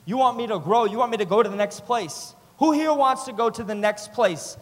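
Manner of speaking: loud, high rallying sermon climb; full-force preaching. Acted. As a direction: drive the crowd up toward the call.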